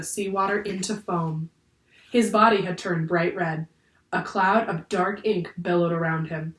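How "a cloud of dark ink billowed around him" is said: ordinary delivery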